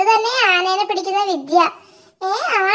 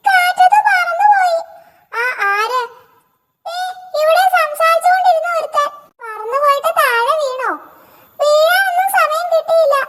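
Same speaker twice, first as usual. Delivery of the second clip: little monkey voice; 8 semitones higher than usual. Acted. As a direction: speak as the worried baby monkey. Small monkey character voice. (ഉയർന്ന സ്വരത്തിൽ വിഷമിക്കുന്ന കുട്ടിക്കുരങ്ങൻ)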